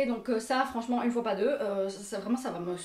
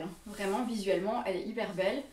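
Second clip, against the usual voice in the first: ordinary talk, nothing marked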